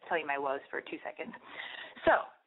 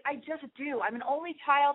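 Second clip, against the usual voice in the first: ordinary talk, nothing marked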